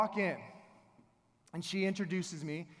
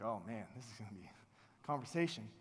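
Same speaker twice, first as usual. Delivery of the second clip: low, hushed inner groan of dread; dismayed aside; 9 semitones lower, 9 dB quieter, faster than usual. Retold or imitated. Teacher to himself before a hard talk, dismayed aside. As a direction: say it under your breath, bracing yourself.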